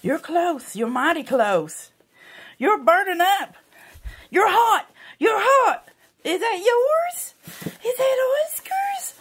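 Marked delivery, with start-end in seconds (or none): 2.6-9.1 excited pet talk. Real, not acted. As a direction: hype the dog up, high and bright. Cheering dog near the toy.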